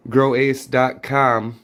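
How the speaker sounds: normal talking voice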